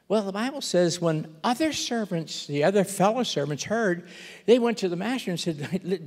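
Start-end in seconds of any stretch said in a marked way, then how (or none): none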